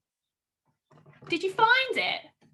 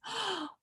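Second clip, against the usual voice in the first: sharp gasp; 7 semitones higher, 10 dB quieter than usual. Acted. Fox gasps in story.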